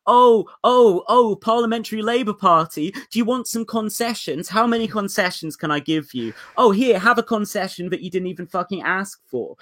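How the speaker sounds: mock simpering, begging to give concessions; mock fawning plea. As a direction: ham up a desperate appeaser. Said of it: "which is literally just".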